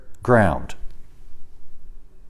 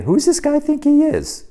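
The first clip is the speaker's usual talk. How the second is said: indignant thought. Retold or imitated. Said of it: "You'd be thinking".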